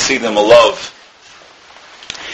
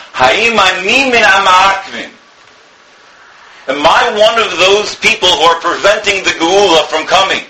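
a higher, tearful cry of a rabbi in anguish; anguished plea; 8 semitones higher than usual. Retold or imitated. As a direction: lift the voice into a pleading, broken question. Broke down in unconsolable tears.

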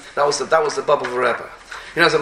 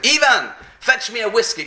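higher, mildly shouted command; retold shouted order; 5 semitones higher, 4 dB louder than usual. Friend ordering disguised Napoleon about, retold shout. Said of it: "his friend shouted at him".

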